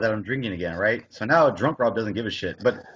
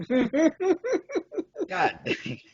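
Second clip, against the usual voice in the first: high laughing exclamation; laughing at his own story, high. Real, not acted. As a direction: laugh it out, pitched way up. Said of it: "laughing at the story".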